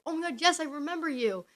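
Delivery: high-pitched acted-out delight; excited recognition. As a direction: imitate gushing on recognizing someone. Retold recognition of chat members.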